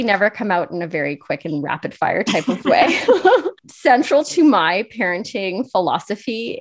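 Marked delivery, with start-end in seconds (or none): 3.0-3.5 laugh. Real, not acted. Host laughing at own joke.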